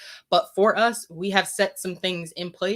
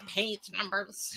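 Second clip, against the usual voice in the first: nerd voice; 3 semitones higher, 9 dB quieter, slower than usual. Retold or imitated. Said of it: "Went into, like, nerd voice".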